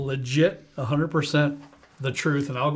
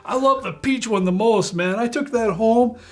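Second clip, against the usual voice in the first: guy in the store; 7 semitones higher, 3 dB louder than usual. Retold or imitated. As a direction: gush like an eager stranger. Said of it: "there was a guy there ... and he goes".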